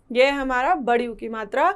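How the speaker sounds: ordinary talk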